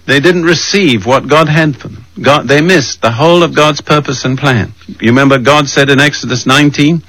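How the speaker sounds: normal talking voice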